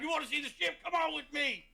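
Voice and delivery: high, proud eager voice; puffed-up halfling. Acted. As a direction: invite a newcomer along proudly. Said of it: "He's, like, all puffed up".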